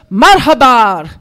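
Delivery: loud, booming imitated hello; big, cheerful shout of welcome; hearty greeting. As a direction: call out a bouncy young man's greeting. Acting young man's loud greeting.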